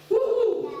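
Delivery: high, loud celebratory woo-hoo; joyful whoop. Real, not acted. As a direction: whoop like the crowd just cheered. Preacher whoops to the crowd.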